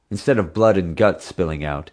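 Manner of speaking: plain reading voice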